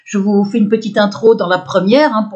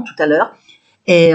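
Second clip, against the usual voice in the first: ordinary delivery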